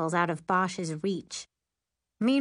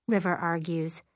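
plain reading voice

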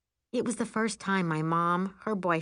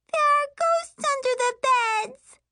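put-on high, loud frightened little girl; little girl wailing; 6 dB louder than usual. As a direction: wail like a scared child. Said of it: "she wails".

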